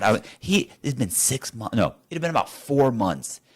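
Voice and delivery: usual speaking voice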